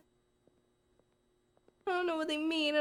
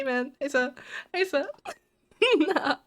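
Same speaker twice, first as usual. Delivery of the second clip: ordinary delivery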